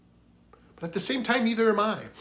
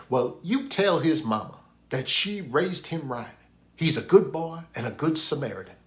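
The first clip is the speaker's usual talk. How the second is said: put-on South Carolinian accent, pitched up; higher-voiced imitation Southern accent; 4 semitones higher, 4 dB louder than usual. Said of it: "again with the best South Carolinian accent".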